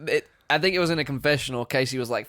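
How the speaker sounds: ordinary talk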